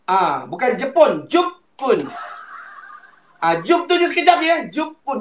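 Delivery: ordinary talk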